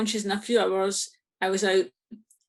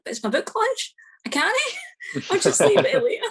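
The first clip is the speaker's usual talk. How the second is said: high, laughing it's my book launch; laughing protest; 11 semitones higher, 3 dB louder than usual. Retold or imitated. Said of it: "And I'm like".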